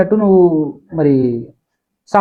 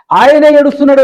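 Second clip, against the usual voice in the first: mock cry; 7 semitones higher, 6 dB louder than usual. Mother cries out to crowd.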